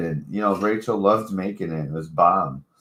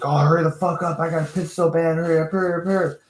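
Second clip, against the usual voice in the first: higher, urgent mock girl voice; impatient roommate voice; 11 semitones higher, much faster than usual. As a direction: hurry her along, desperate to pee. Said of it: "my roommate came home and she's like".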